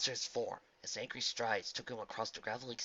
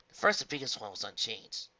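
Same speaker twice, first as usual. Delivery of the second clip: plain reading voice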